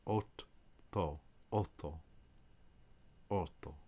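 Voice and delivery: otto with held tt, OT-to; Italian geminated t; Italian double t. Shown as a demonstration. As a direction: repeat otto holding the double t. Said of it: "you pronounce them both".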